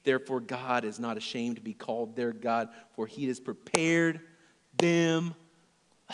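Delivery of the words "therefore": usual speaking voice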